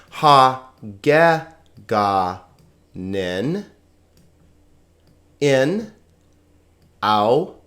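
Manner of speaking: "Ha geganin in au to zo a ain" in isolated syllables, long gaps. Shown as a demonstration. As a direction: sound out each syllable of "Ha geganin in au to zo a ain" slowly. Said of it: "on to the pronunciation".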